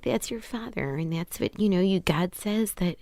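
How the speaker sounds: hushed mock Minnesota mom; very softly imitate a pious Midwestern mother; mother's Midwestern accent. Mother telling child to honor parents, in hushed Midwestern accent. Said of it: "like Minnesota".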